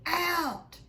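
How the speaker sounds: loud, high bellowed order; roar. Acted. Furious uncle roaring the boys out of the kitchen. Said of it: "roared Uncle Vernon".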